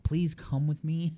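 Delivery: lightly sweetened staff voice; polite helper voice. Retold or imitated. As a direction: coax her kindly, slowly. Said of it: "I'm like".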